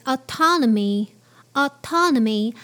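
"autonomy" with an American open ah start; American English. Shown as a demonstration. Teacher modelling American English autonomy. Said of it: "in American English".

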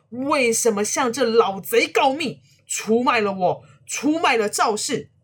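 high, loud, furious scolding of a traitor; furious cursing. A narrator's reading. Old man curses the friend who betrayed him, furious. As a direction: spit the insults at him, full rage. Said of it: "破口大骂".

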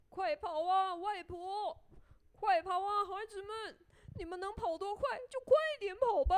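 high, loud, gleeful taunting; laughing shout. A narrator's reading. Gingerbread man goading all his pursuers, laughing shout. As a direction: call out high with a big mock laugh. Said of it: "又笑又大喊着说".

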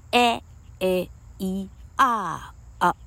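"A A E R啊" as spaced-out short vowels; short vowel drill. Shown as a demonstration. Teacher modelling a chant for students, short vowel drill. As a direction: say "A A E R啊" as five short-vowel sounds, slowly separated. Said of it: "A E I O U念作".

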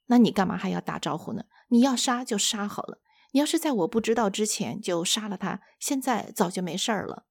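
usual speaking voice

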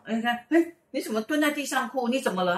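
high-pitched, alarmed questioning; acted startled concern. Classmate finds him crying by the road, acted alarmed concern. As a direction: imitate a friend taken aback.

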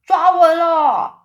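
high, drawn-out bellow; loud holler. A narrator's reading. Old green grasshopper warns everyone they are off, hollering. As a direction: stretch each call long and loud. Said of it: "高声喊着说".

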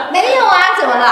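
laughing loud reply; loud, high laughing "no, why?". Real, not acted. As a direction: burst out laughing then answer.

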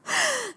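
quick high laugh at herself; sheepish laugh. Real, not acted. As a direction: laugh at her own recklessness. Laughs at own rashness. (不好意思地笑)

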